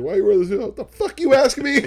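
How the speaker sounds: high, loud comic indignant voice; Martin Lawrence impression. Man answering a nosy shoe question, loud comic outrage. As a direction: bark back like an offended comedian. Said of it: "wanna be like Martin Lawrence".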